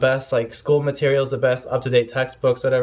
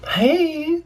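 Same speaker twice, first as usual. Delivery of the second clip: calling out. Real, not acted. Calling out to the cat.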